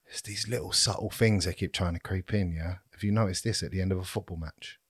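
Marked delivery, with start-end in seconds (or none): none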